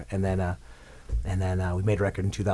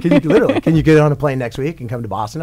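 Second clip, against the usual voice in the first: excited; 3 semitones higher, 8 dB louder than usual. Retold or imitated. Retold excited phone call.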